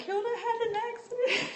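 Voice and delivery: high, laughing child imitation ending on "accident"; mimicked schoolchildren. Remembering what all the children said, high laughing mimicry. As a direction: squeal it like kids, breaking into laughter. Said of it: "It was like".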